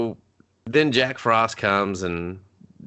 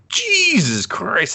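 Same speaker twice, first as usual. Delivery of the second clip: high, drawn-out exclamation; exasperated disbelief; 10 semitones higher, 4 dB louder, much slower than usual. Retold or imitated. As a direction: stretch it out, aghast. Retold exasperated reaction to worthless currency.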